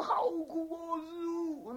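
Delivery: high, pitiful mock whine; whining lament. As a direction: moan high to the master for sympathy. Acted patient whining to his master. (模仿病人高声诉苦)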